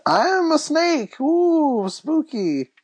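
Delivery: high, slow mock spooky voice; mock spooky snake. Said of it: "He just said".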